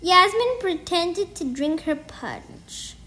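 plain reading voice

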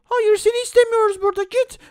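put-on high, dismissive child voice; snooty bully. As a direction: shoo him away with disdain. Bully rejects Fakir.

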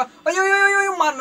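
high, panicky string of yelps; alarmed yelping. Real, not acted. Gamer begging not to be hit, high alarmed yelps. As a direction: cry out in alarm at being attacked. Under attack in the game.

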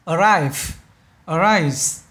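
"Arrive" ending in V, "arise" in an S-like sound; contrasted final sounds. Shown as a demonstration. Teacher shows students the differing final sounds of "Arrive" and "arise". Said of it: "khác biệt âm cuối này".

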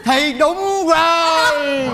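very slow, last word held long; drawn-out cry. Acted. Father agrees with his wife, wailing it out slowly. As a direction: stretch the agreement into a long wail.

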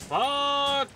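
high, held-out curse; exasperated yell. Real, not acted. Player cursing yet another enemy, exasperated yell.

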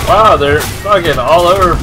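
ordinary delivery

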